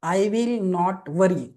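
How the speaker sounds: very slow, word by word, so they can write it down; slow dictation. Shown as a demonstration. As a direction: dictate each word slowly. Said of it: "second principal लिहून घ्या". (हळू हळू लिहून घेण्यासाठी सांगणे)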